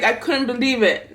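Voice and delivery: ordinary talk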